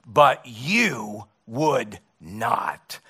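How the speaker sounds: very slow, falling; slow regretful close. Retold or imitated. The Lord closing his lament to a believer, slow and regretful. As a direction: let it drop, slowly and sadly. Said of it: "have the Lord say to us".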